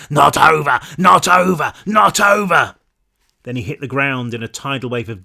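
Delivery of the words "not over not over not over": choked monster cry rising to a loud shout; the dying beast refuses to fall, louder each time; choked monster words. Acted. The dying monster Patrick defiant, building to a shout. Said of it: "he was muttering choked words".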